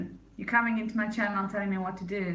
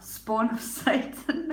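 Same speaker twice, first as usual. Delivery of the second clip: laughing through words; 4 semitones higher, slower than usual. Real, not acted. Laughs mid-phrase.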